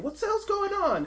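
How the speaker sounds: high-pitched, drawn-out bewildered cry; bewildered crowd. Acted. Game master voicing puzzled club-goers, high bewildered cry. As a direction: cry out high and loud, in mock alarm. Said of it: "people are just wondering".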